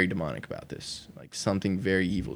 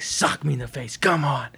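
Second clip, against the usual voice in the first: higher retold taunt, building to a louder dare; antagonizing; 6 semitones higher, 3 dB louder than usual. Opponent daring him to throw a punch, antagonizing. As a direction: goad him into it, imitating a provoker. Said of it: "he's, like, antagonizing".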